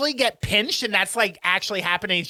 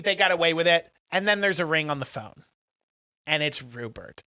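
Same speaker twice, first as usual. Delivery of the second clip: ordinary delivery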